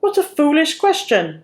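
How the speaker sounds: mock the foolish question; mock roar. A narrator's reading. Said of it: "roared the farmer".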